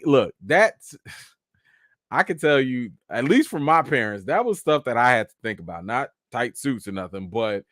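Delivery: ordinary talk, nothing marked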